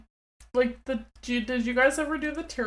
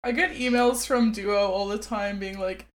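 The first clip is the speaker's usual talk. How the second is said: ordinary delivery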